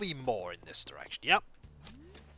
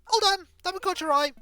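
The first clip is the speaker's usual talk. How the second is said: much higher, louder cheerful shout; eager shopkeeper call; 6 dB louder, faster than usual. Acted. Shopkeeper stopping a leaving customer, bright loud call. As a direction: call them back before they leave.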